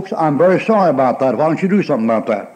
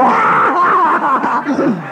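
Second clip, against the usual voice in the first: much higher, shouted laugh thrown back; laugh right back; 11 semitones higher, much slower than usual. Retold or imitated. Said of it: "you stand there and go".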